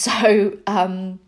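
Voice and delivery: usual speaking voice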